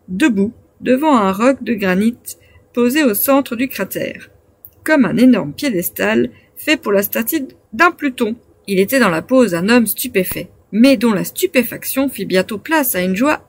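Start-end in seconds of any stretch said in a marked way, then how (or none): none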